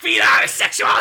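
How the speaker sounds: shouted breathy mock Tarantino; hammed-up shouted outburst. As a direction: blurt it out like a crazed director. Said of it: "he's like".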